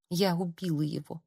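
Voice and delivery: very soft, hushed confession; whispering. A narrator's reading. Girl repeats it walking the corridors, whispering. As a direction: murmur it to yourself, hushed. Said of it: "шептала девушка".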